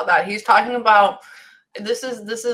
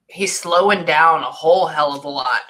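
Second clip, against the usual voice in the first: normal talking voice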